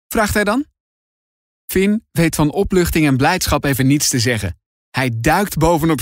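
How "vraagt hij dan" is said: usual speaking voice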